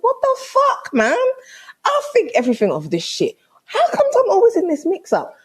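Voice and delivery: high-pitched, incredulous protest; exasperated outburst. Retold or imitated. Girlfriend fed up with being in this mix-up, exasperated. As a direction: start right up high in sheer exasperation. Retold row with boyfriend.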